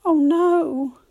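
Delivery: loud "oh no" of despair; cried out. Acted. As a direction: cry out in dismay. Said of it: "cried George".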